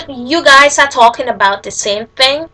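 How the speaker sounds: ordinary talk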